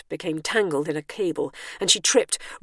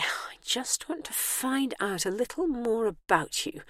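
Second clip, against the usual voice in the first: soft whisper; 6 semitones higher, 6 dB quieter than usual. A narrator's reading. Bernice soothing.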